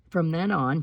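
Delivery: plain reading voice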